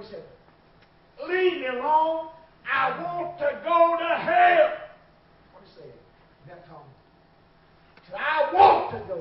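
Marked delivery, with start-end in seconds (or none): 1.3-4.7 retold furious outburst, slow and loud; the driver yells his refusal, dragging it out; defiant shout. Truck driver refusing the preacher. 8.2-9.2 retold shout. Repeating the driver's refusal.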